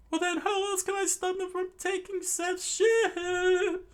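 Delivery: very high squeaky voice; Sanji voice. Acted. Sanji pleading with Luffy, high squeaky voice. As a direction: whine it desperately. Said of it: "Sanji replied".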